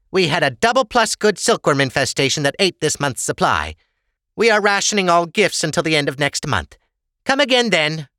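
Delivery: high-pitched official explaining the rules; dismissive official. Acted. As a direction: turn the customer away, voice pitched high. The hand turns Ava away.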